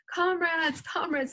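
high, pleading call; shouted. A narrator's reading. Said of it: "they shouted".

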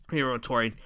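plain reading voice

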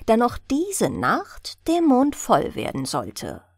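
plain reading voice

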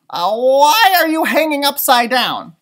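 high, loud stranger voice. Acted. Stranger loudly asking Stellaluna why she hangs upside down. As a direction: boom it out, puzzled. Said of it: "said a loud voice".

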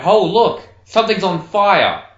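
ordinary talk, nothing marked

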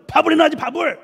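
high, loud mock outburst; acted angry husband. Husband scolding wife for no meal, mock furious. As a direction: explode over an unmade dinner. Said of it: "화를 내는 거야".

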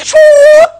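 high, loud screech of a sudden stop; mock braking screech, shouted high; imitated screeching halt. Horse stops at the edge of a ravine, loud high screech.

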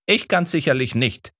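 put-on high, sharp exclamation from an old professor; Summerlee calling out. A narrator's reading. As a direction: call it out, high and indignant. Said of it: "rief Summerlee".

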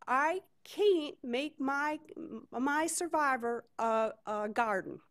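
drawn-out Southern plaint, starting high; amateur Southern accent, high then settling; [name]'s Southern accent. Acted. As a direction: put on a slow, woeful Southern voice. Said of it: "try to do a Southern accent".

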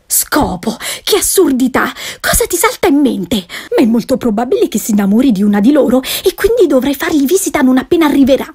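hammed-up indignant society wife. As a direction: brush him off and rush on. Wife dismisses husband's question.

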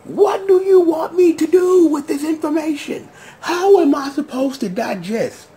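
high, overwhelmed questions; retold bewilderment. Recalling his overload at the news, high bewildered plea. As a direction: plead for sense. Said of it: "I'm like".

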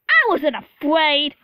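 loud, drawn-out indignant protest; huffy, offended outburst; Gordon huffing. A narrator's reading. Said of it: "huffed Gordon".

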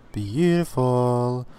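"Beautiful" stretched slow and savoured; a slow, hushed, marvelling "Beautiful"; drawn-out admiring beautiful. Real, not acted. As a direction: linger on "Beautiful" as if in awe. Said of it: "oh my god, that's beautiful".

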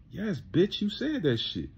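ordinary delivery

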